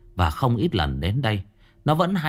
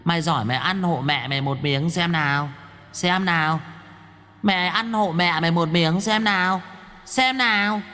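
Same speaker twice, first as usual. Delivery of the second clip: intimidating taunt; 6 semitones higher than usual. A narrator's reading. Violet bullying the head while kicking it, rising taunt. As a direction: rise higher with each xem nào. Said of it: "bắt đầu thị uy".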